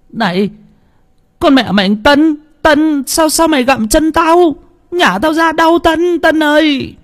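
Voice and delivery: put-on high, yelping boy voice in sudden pain; boy crying out in pain. A narrator's reading. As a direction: yelp it out like a bitten boy. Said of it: "Nó rú lên". (thằng bé rú lên vì đau, giọng cao)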